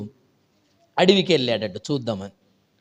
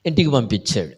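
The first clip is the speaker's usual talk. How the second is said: ordinary delivery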